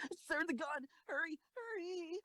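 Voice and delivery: high, frantic pleading; panicked urging. Acted. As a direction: rush them in fear. Crew panicking under fire.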